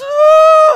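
very high, loud imitated wail; exaggerated crying howl; mock bawling. Crying girl hearing that God loves her, mock bawling wail. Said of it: "she starts bawling".

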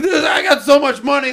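high, loud reenacted brag; excited boast. Recalling his first big earnings year, excited boast. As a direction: crow about sudden riches, pitch soaring.

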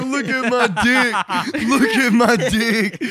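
put-on high, loud, drawn-out drunken boasting; drunk bragging. Drunk guy showing roommate his glow toy, giddy and loud.

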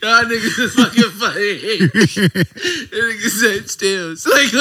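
high, loud words broken by laughter; can't stop laughing. Real, not acted. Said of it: "I'm having too much fun".

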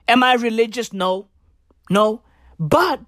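ordinary delivery

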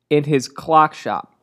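ordinary talk

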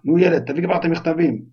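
impatient yell from the next room; shouting uncle. Acted. Uncle calls the boy from the kitchen, impatient shout. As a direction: bark it out, fed up with the boy. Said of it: "צעק מהמטבח".

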